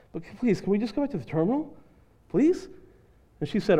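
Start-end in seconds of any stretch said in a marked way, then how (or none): 0.1-2.7 soft begging, rising at the end; meek pleading. Retold or imitated. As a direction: beg gently, lifting the final please. Pleads with attendant to turn back.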